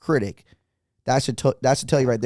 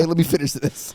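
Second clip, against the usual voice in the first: mock distracted husband; 4 semitones higher than usual. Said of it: "He's yelling at".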